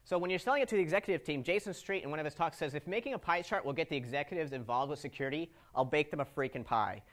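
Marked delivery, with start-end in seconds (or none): none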